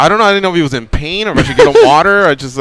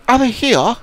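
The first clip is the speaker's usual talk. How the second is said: put-on garbled high New Zealand accent; mock a swallowed Kiwi accent, like a sneeze; Lucas; 9 semitones higher than usual. Host imitating a hard-to-follow caller, garbled high Kiwi accent. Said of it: "Lucas from New Zealand will go like".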